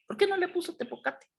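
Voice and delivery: high, quick mock question; mock questioner. Teacher voices an imagined questioner.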